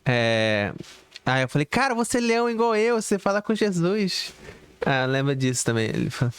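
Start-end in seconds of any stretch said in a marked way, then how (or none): 1.7-4.3 pitched way up, eager, telling him he's special; retelling how he hyped up Yudi, excitedly; excited retold quote. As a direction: replay the moment you cheered him on, higher. Retelling his own words to Yudi.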